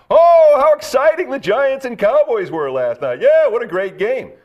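high, loud gushing fan voice; mock excitement. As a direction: overdo the excitement sarcastically. Mocking what everyone's saying.

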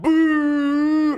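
high, loud mock boo; imitated crowd boo. Crowd booing comedian.

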